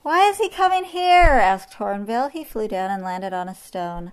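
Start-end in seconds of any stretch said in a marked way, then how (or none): 0.0-1.3 high, loud, dismayed question; alarmed question. A narrator's reading. Hornbill asking about the approaching lion, alarmed. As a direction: pitch the question way up.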